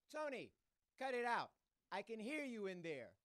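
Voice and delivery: loud, high parental yell. Retold or imitated. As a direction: yell through the door at him. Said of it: "So I yell".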